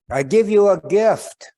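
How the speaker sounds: high, loud solemn eagle voice; eagle's proclamation. Acted. Eagle announcing a gift to the old man, high and loud. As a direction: proclaim it from the branches.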